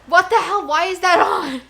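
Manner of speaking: real shocked exclamation; startled outburst. Real, not acted. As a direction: react sharply in alarm. Startled by something turned on.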